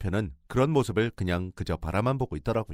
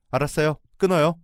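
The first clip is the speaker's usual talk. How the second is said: curt hang-up; 6 semitones higher, 6 dB louder than usual. Retold or imitated. Cutting off the call curtly.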